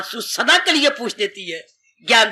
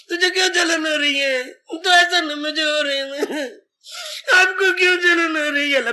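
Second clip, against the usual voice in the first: tearful whine, higher, half-acted; mock crying; 4 semitones higher than usual. Family member whimpering to the sick one, mock tearful. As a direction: play a weeping relative, half in jest.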